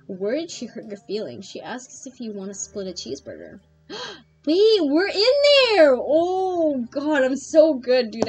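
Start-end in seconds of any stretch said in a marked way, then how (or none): 3.9-8.3 excited. Real, not acted. Excited about winning date.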